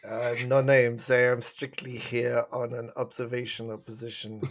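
guarded pantomime Russian agent; Russian KGB voice. Pretend. Co-host refusing to give names, mock Russian KGB accent. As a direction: play a secretive KGB observer with a Russian accent. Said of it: "our Russian pantomime K G B voices".

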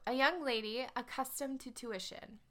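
put-on reading the ad in a light English accent. Reading Jane's old newspaper ad aloud, light English accent. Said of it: "her ad was, um, in an English accent".

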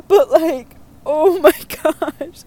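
giggling oh my gosh; laughing it out. Real, not acted. Cracking up at the story.

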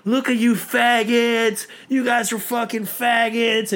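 high, loud, slow retold yell; mock jeering shout; imitated heckler yelling. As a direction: holler abuse across a crowd, imitating a heckler. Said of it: "yelling at us like".

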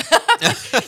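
high, loud laugh through real tears; a wet, emotional burst of laughter; teary laugh. Real, not acted. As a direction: laugh while choking up. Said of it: "I'm tearing up".

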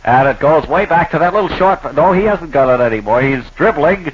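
announcer. Acted. Mock basketball play-by-play.

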